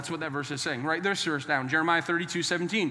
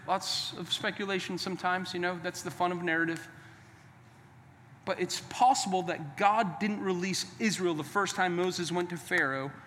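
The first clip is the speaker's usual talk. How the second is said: usual speaking voice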